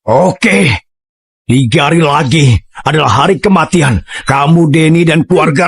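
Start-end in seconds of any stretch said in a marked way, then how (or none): none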